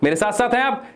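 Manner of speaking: usual speaking voice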